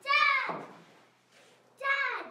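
loud pleading yell; yelling for dad. Acted. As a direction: cry out for dad in distress. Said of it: "So yell, run in and yell".

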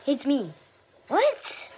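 loud, high shriek of disbelief; yelling at the screen; outraged yell. Real, not acted. Outburst at unfair kill.

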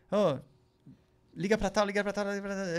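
mock boss firing off orders; bossy order. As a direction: rattle off commands like a pushy boss. Boss barking orders on phone.

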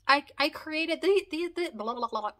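excited stumble into blah-blah babble; tongue-tied. Real, not acted. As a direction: trip over the words, then give up in babble. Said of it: "I can't talk right".

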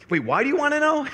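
sudden high-pitched puzzled question; suspicious double take. Retold or imitated. A taken-aback dad questioning a stranger, high mock-suspicious double take. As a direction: imitate a dad abruptly catching himself.

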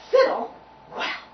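high, loud affronted outburst; indignant. Acted. Violins offended at being called fiddles, indignant. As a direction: throw the word back, offended. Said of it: "quivered with great indignation".

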